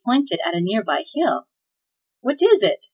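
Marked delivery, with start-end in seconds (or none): 2.2-2.9 startled high sputter; muffled startled elf. Acted. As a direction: blurt it out in alarm, pitched up. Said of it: "sputtered Tweedlenees".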